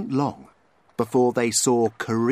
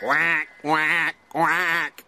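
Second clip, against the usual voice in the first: high duck quacks; happy little duck quacking; 5 semitones higher, 3 dB louder, much slower than usual. A narrator's reading. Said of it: "opened his beak and said".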